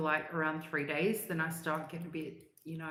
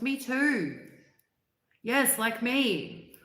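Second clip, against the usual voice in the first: high, loud and eager; delighted to find someone just the same; excited; 7 semitones higher, 7 dB louder, much slower than usual. Real, not acted. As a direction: pounce on it, me too. Eager reply to chat.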